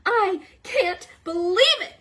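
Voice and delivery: high, slow, put-on voice of disbelief; stunned turtle professor. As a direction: gasp it out as a shocked old professor.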